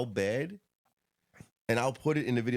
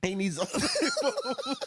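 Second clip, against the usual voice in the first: laughing; 13 semitones higher, slower than usual. Real, not acted. Joking about the cop's lamp.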